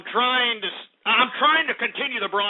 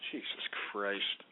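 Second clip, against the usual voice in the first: whispered, much lower and softer; exasperated whisper; 12 semitones lower, 15 dB quieter, slower than usual. Real, not acted. Host whispering in despair at callers. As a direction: breathe it out in despair.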